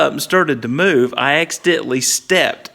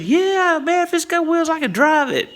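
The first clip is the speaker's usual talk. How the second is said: much higher, breezy bravado; cocky bluff. Retold or imitated. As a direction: bluff it like nothing scares you. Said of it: "But I just said".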